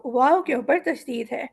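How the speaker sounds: ordinary talk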